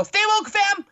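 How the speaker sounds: high, loud mock-excited exclamation; hammed-up catchphrase. Acted catchphrase reply to sign readers.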